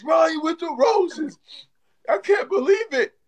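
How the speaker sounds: high-pitched elated disbelief; overjoyed cry. Real, not acted. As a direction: cry it out high in amazed celebration. Thanks a gifter, overjoyed.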